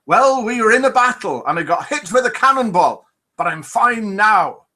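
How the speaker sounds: hammed-up pirate that misses the accent; a pirate in a joke, voiced half-heartedly high; failed pirate accent. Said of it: "my pirate accent".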